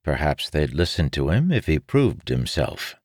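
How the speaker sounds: usual speaking voice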